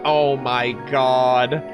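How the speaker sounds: slow, low, drawn-out disbelief; stunned realization. Real, not acted. Reader struck by the meaning of the name, slow and stunned. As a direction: stretch it out as it sinks in.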